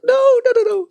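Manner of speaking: high, loud string of refusals; mock panicked protest. Sibling recalling inner panic at brother's reaction, mock alarm. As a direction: squeal it out in comic retold dismay.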